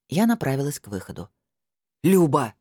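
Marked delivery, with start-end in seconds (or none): 2.0-2.5 громкий окрик вдогонку; остановить её громким «Люба, стой!»; взвился. Acted. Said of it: "взвился Скороход". (громкий встревоженный окрик)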